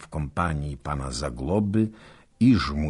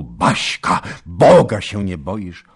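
angry outburst, louder; angry shout; 2 semitones higher, 9 dB louder than usual. Acted. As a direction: scold wife for risking herself. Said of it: "już zakrzyknął". (gniewny okrzyk)

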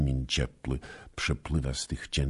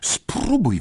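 defiant dare; 14 semitones higher, 7 dB louder than usual. Acted. Basia challenging again.

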